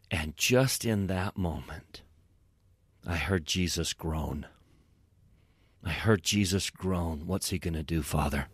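low, soft, reverent hush. Retold or imitated. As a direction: drop the voice right down, softly. Hushed retelling of the turning moment.

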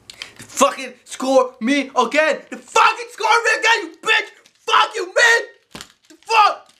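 high screaming meltdown; building to a furious, high-pitched shriek; angry rage quit. Acted. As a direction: scream it as you lose the game.

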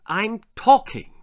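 high, loud motherly protest; indignant mother. A narrator's reading. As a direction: protest at being interrupted. Mother objects to interruption.

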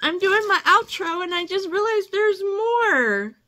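high-pitched, emotional disbelief; overwhelmed surprise. Real, not acted. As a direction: wail it happily in amazement. Overwhelmed at finding another gift.

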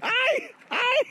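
high startled cries; surprised yelps. Real, not acted. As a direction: yelp each time. Slipping into water.

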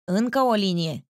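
lightly voiced fat man, a touch hearty; a hint of a fat man's hearty exclamation; fat man's hearty call. A narrator's reading. Stout man calling out another line at dice, lightly hearty. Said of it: "cu un râs sonor de om gras".

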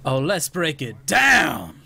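slow loud hype callout; hype shout. Retold or imitated. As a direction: belt it like a DJ. Quoting Lucio's ult voiceline.

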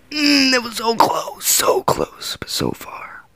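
fading into a crushed whisper; disappointed whisper. Real, not acted. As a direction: let it sink to a whisper after the near miss. Near miss on the jump.

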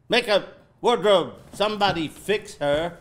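loud, high call for help; alarmed director barking for staff; urgent yell. Acted. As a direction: shout orders to the crew. Director calls for crew help.

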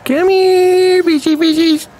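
high sing-song come-here call; playful high coaxing to the fishies. Pretend. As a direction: lure them in like calling a pet. Coaxing in-game creatures.

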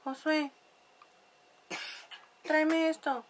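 a hint of her own nasal everyday voice; imitating own nasal voice. Mother imitating herself calling to her husband, mildly nasal. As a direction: lightly mimic yourself calling to family. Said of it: "me, me oigo así".

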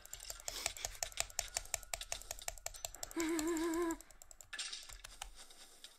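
long giggling laugh; high laugh. Real, not acted. Gamer laughing at the crowded game spot, high giggle. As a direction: laugh giddily on and on.

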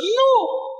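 a sudden high, firm no; emphatic no. Acted. Preacher answering his own question with a sharp, high no. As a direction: shoot the idea down with a leap up in pitch.

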